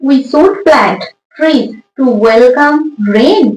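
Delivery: each word drawn out slowly for the class; very slow dictation. Shown as a demonstration. Teacher reading the question to pupils, very slowly. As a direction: spell it out slowly so children follow. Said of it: "teacher reads the sentence aloud".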